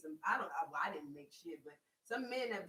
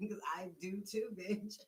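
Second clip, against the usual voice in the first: usual speaking voice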